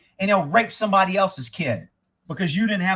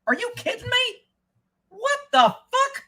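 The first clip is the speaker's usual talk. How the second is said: incredulous disbelief. Real, not acted. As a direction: stretch it up high, unable to believe it. Incredulous reaction to news.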